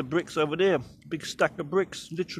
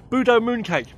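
Budo moon cake punched out louder and higher; louder, higher repeat; 5 semitones higher, 8 dB louder than usual. Shown as a demonstration.

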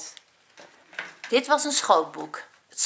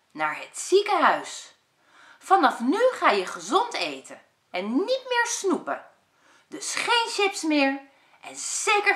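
put-on bright, raised voice of a bossy little boy; bossy Job; 2 semitones higher than usual. Job tells lazy Ad to live healthy, bossy voice. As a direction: lecture your brother like a know-it-all kid.

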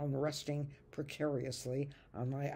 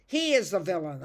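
loud, higher accusing hiss; hissed accusation; 4 semitones higher, 9 dB louder than usual. Acted. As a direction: point and hiss out the villain. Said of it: "I hissed".

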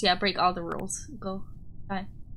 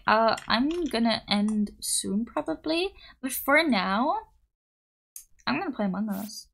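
ordinary delivery

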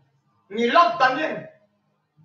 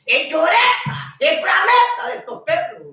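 mocking jeer; 7 semitones higher than usual. Real, not acted. Preacher mocking rival pastors on air, high scornful jeer. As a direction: sneer at the crying pastors.

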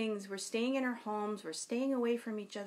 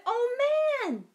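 excited exclaim; 9 dB louder than usual. Acted. Narrator boy exclaims in story.

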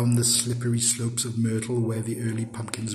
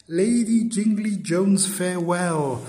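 high, louder farewell call; Yonghy Bonghy Bò; 7 semitones higher, 4 dB louder than usual. A narrator's reading. Departing suitor bids the lady farewell, high lilting call. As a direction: call goodbye in a lilting, half-sung way. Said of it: "sang the Yonghy Bonghy Bò".

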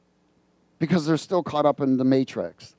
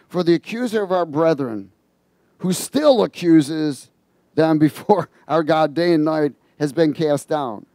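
scripture reading; 3 semitones higher, 5 dB louder than usual. Acted. Reads scripture aloud.

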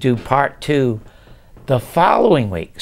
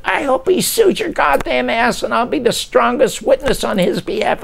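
high, heated outburst; screaming; 12 semitones higher than usual. Retold or imitated. Writer threatening the producer before slamming the phone down, screaming. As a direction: rant it high and indignant down the line. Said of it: "I start screaming at him".

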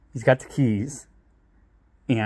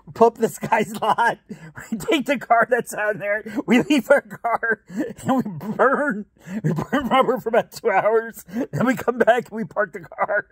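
gleeful confession; 10 semitones higher than usual. Retold or imitated.

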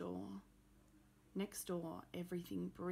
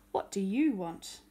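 put-on louder, gruff old man's snap; grumpy demand; 11 dB louder than usual. Mister Wintergarten confronting Rose at his door, loud and grumpy. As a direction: slam the question at the intruder.

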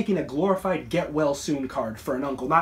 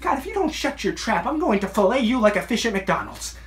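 exasperated, raised and high-pitched threat; angry threat; 6 semitones higher, 4 dB louder than usual. Acted. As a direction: blow up at them, pitch climbing. Losing temper, threatens his son.

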